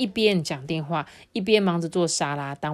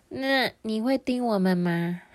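put-on high, slow child voice; put-on small-child question; little sister; 4 semitones higher than usual. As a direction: ask as a wary little girl.

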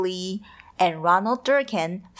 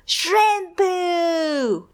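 taunting bully; 8 semitones higher than usual. A narrator's reading.

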